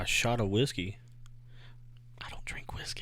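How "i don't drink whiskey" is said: breathy whispered confession. Real, not acted. A friend whispering a confession to his podcast partner. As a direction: drop to a whisper to admit it. Confessing aside after bravado.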